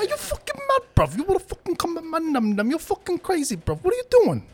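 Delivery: squealing London gangster outrage; high-voiced Top Boy accent. Friend imitating Top Boy guys, very high London accent. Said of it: "when their voices get high".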